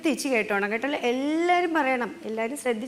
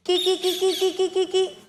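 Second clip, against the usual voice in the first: high repeated kee-kee chirps; parrot call; 4 semitones higher than usual. Shown as a demonstration. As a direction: chatter like a parrot in quick short notes. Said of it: "തത്തമ്മ വന്നിരുന്ന് എങ്ങനെയാണ് വിളിക്കുന്നത്".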